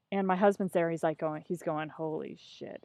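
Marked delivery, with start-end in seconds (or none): 1.9-2.8 low, hushed disbelief; stunned aside. Retold or imitated. Husband watching his wife grill the teacher, stunned aside. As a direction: say it under your breath, appalled.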